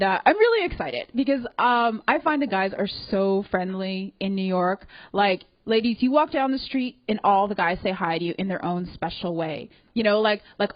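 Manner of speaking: ordinary talk, nothing marked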